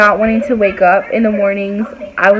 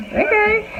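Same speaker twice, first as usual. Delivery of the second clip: meek okay; 10 semitones higher, 6 dB quieter than usual. Retold or imitated. Acts backing off from coworker.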